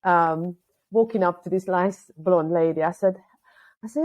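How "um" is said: ordinary delivery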